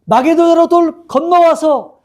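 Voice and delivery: high, drawn-out beckoning cry; calling out. Retold or imitated. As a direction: imitate someone calling slowly across a distance. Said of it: "손짓하면서 외쳐요". (손짓하며 높고 길게 외치기)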